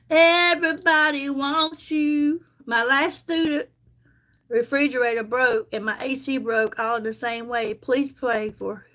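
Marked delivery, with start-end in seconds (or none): none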